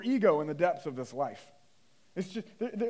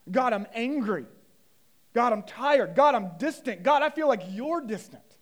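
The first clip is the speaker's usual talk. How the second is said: loud, raised cry to God; impassioned acted prayer; 4 semitones higher, 8 dB louder than usual. Preacher voicing honest prayer to God, impassioned. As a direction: pray it aloud with rising force.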